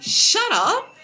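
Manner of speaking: high, loud playful "shut up"; high mock snap. To her husband, a high loud teasing shut up. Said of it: "I always tell him".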